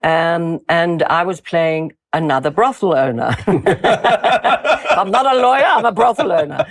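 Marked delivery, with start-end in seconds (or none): 3.4-6.7 words drawn out slowly through laughter; laughing at the joke; slow, laughing correction. Real, not acted.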